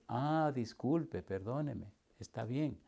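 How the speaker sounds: soft, low, slow apology; meek apology. Retold or imitated. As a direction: voice a gentle, placating apology. Retold meek apology to customer.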